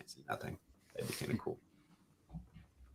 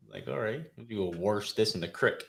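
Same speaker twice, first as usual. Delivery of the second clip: ordinary talk, nothing marked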